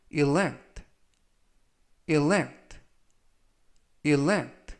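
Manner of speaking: careful "elect" with a falling end; slow model reading. Shown as a demonstration. Teacher modelling a word for students, slowly. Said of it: "천천히 세 번씩 읽어드리고요".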